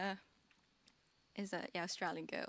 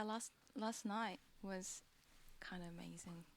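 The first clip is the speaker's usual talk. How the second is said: whisper; 3 semitones lower, 10 dB quieter than usual. Real, not acted. Guest to host about last night, fading to a whisper. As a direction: hush it as if sharing a secret. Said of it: "whisper heard".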